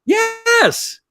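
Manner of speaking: high, drawn-out excited yes; thrilled, long-held high exclamation; delighted recognition. Real, not acted. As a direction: stretch it out high with glee. Recognizes the comic's name delightedly.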